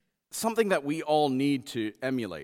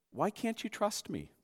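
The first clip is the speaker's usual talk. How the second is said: softer, gentle question; compassionate; 5 semitones higher, 8 dB quieter than usual. Acted. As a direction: ask it with tender compassion. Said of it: "beautiful, compassionate voice".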